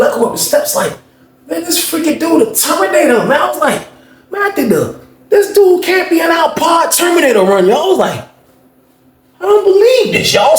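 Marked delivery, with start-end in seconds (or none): 1.5-10.6 excited high shout. Real, not acted. Telling viewers about the Terminator in the pod, excited shout. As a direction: yell it out in shock.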